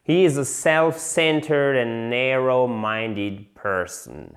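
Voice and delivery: slow, careful English model sentence. Shown as a demonstration. Teacher reading the example sentence slowly to the class. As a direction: say each word clearly for learners. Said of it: "Regardez, dernier exemple".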